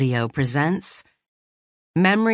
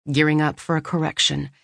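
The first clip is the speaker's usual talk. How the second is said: ordinary delivery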